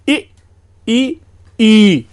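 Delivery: इ short, ई long, last ई stretched longer; short, long, extra-long i. Shown as a demonstration. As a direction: demonstrate इ then ई then a held ई. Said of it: "उसी को थोड़ा सा और खींच लीजिए". (इ, ई और खिंचा हुआ ई)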